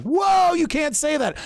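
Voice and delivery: high, startled mocking gasp of outrage; mimicking critics who gasp at his praise of a protester; mock shocked conservative.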